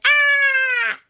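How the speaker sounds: very high, loud, long shriek; shrieking ride scream. Acted. Boy screams on the roller coaster, high shriek. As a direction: scream as on a big drop.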